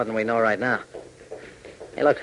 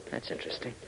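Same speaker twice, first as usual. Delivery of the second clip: whispered to himself, softer; 12 dB quieter than usual. Acted. Hop noticing the petroleum clue, whisper. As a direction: breathe it out as a private thought.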